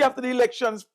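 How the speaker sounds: ordinary talk, nothing marked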